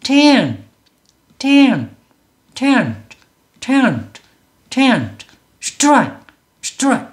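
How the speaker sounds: tent and strike as single high, falling bursts; one-syllable English. Shown as a demonstration. Teacher shows one unit of sound, single-syllable English. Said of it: "이렇게 소리 단위가 하나라는".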